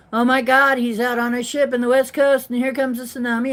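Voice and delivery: fast, high, loud panicked friend. Retold or imitated. Friend calling in a panic about a tsunami, fast and loud. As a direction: rush it out like a frightened friend.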